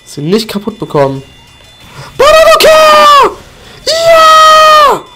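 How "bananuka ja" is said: squeaky, loud, drawn-out war cry; high cartoon battle cry. Player hurling a grenade with a high cartoon battle cry. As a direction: yell it like a shrill cartoon critter.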